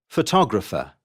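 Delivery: photographer as pho-TOG-ra-pher; shifted stress. Shown as a demonstration. Teacher showing the shift, photographer stressed on TOG. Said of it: "word stress does not stay on the same".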